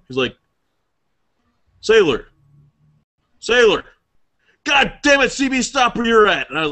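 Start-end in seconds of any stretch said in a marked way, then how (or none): 1.8-6.5 angry officer yelling. Retold or imitated.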